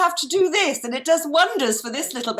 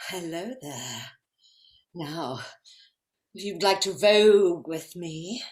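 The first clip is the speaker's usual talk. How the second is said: deep seductive voice; 9 semitones lower than usual. Acted. Character inviting a partner to vogue, low purring voice.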